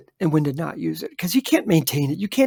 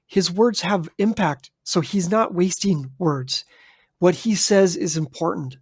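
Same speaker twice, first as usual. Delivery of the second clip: measured, weighty delivery; slow down and give each word impact; deliberate pace, no wasted words; slow, thoughtful speaker. Shown as a demonstration. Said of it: "This is just done by speed".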